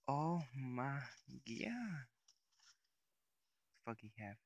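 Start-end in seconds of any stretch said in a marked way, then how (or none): 0.1-2.1 higher, louder startled cry; shocked. Real, not acted. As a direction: blurt it out in real shock. Sudden shock in game.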